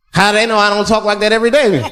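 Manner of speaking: ordinary delivery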